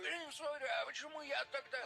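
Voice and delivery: shocked. Retold or imitated. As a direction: blurt it out in disbelief. Retells own flustered reply.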